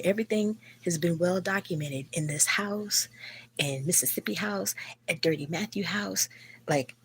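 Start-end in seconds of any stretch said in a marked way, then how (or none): none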